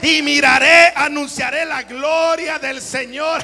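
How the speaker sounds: proclaiming loudly at full force; prédica a toda voz. Acted. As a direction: thunder it out like a preacher. (predicando a gritos)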